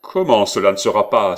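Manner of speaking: loud, indignant question; astonished outcry. A narrator's reading. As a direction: explode in disbelief at being defied. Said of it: "s'écria Benvenuto, aussi étonné que serait un lion".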